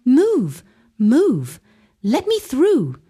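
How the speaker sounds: father forcing his way through the crowd, shouting. Acted. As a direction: shove through the crowd with your voice. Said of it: "he shouted".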